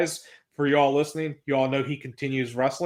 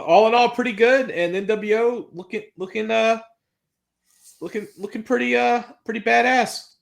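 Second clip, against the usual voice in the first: excited; 8 semitones higher, 3 dB louder than usual. Real, not acted. Gushing wrap-up of the segment.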